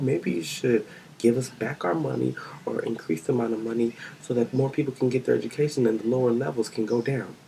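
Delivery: usual speaking voice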